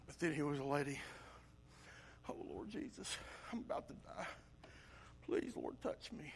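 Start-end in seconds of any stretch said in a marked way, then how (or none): 2.3-6.3 barely voiced whisper; faint desperate plea. Sick woman pleading with Jesus for a touch, faint whisper. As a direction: whisper it as if near death.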